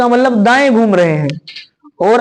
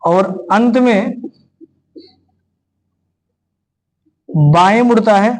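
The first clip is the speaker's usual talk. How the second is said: slow, paused dictation for students to write; feed the class the question phrase by phrase; 3 semitones lower than usual. Shown as a demonstration.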